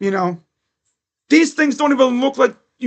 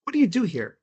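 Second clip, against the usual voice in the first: incredulous; 3 semitones lower, 6 dB quieter, slower than usual. Retold or imitated. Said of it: "You look at him and you're like".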